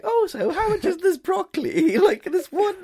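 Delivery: high-pitched mock shopper; very high, innocent shopper impression; greengrocer's customer imitation. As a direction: imitate a naive customer pricing vegetables. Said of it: "like he's gone to the fucking".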